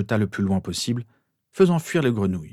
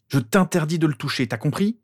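raised pitch, stern warning; angry forbidding; 6 semitones higher than usual. A narrator's reading. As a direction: warn a rival off your property. Owner forbids touching the broom.

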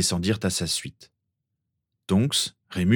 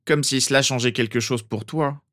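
higher-pitched grumpy jibe; grumbling Ron; 5 semitones higher than usual. A narrator's reading. Boy answers his worried classmate, grumbling. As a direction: grouse at a studious friend, voice pushed up. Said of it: "grogna Ron".

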